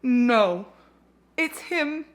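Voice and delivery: usual speaking voice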